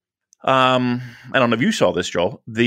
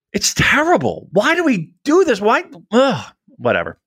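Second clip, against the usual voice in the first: exasperated, higher and pleading; annoyed ugh; 11 semitones higher than usual. Real, not acted. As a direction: groan it in despair. Exasperated at team losses.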